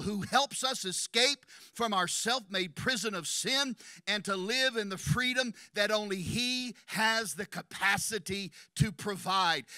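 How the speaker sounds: normal talking voice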